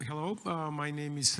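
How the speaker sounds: normal talking voice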